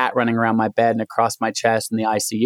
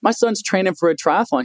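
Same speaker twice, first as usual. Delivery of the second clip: mild mock yell; 8 semitones higher than usual. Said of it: "she yells over to the doctor".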